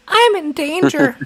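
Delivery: very high, loud, drawn-out cartoon impression; high-pitched cartoon character, loudly exclaiming; Ralph from The Simpsons. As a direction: squeal it slowly in a high cartoon voice. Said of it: "Ralph from The Simpsons".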